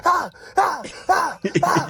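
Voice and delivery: high, far-off wailing whimpers; mock crying. Man crying on floor.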